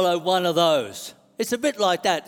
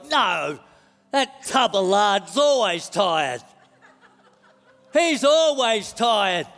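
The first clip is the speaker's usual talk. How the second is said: slow, drawn-out mock indignation; exasperated spirit guy; slower than usual. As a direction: drag out the complaint in comic exasperation. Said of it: "spirit guy's like".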